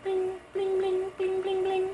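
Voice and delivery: high, sing-song cash register blings; ping out high repeated register beeps; playful high monotone ringing; imitated till noise.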